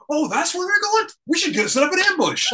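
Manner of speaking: high mock-surprised Megatron. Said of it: "he goes".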